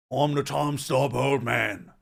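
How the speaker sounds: loud, drawn-out old man, British attempt landing American; slow, forceful old-man character voice; ugly bastard voice. Shown as a demonstration. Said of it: "my ugly bastard voice".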